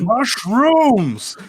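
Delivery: high, loud mushroom shriek; berserk rage. Acted. Character raging about mushrooms.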